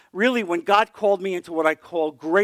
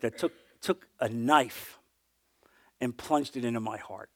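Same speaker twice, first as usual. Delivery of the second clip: usual speaking voice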